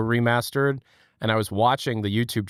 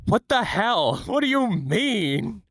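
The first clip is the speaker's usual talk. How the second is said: mock surprise; 10 semitones higher, slower than usual. Reading a shocked chat reaction.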